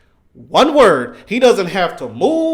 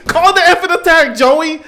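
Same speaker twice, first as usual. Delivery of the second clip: heated yell; 9 semitones higher, 5 dB louder than usual. Retold or imitated. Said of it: "I was so mad".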